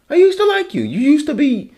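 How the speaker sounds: exasperated outburst. Real, not acted. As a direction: burst out, hurt and fed up. Ranting at John Oliver, repeats it.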